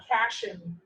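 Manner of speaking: cation said as one word, kat-eye-un. Shown as a demonstration. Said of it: "that this is said".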